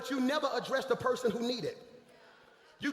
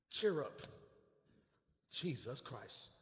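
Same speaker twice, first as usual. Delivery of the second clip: tag; 9 semitones lower, 10 dB quieter, much slower than usual. Acted. Acts quiet words to blind man.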